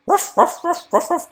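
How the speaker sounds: high yappy little dog barks; squeaky ruff ruff ruff; barking dog. Pretend. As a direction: bark like a tiny puppy. Imitating a dog.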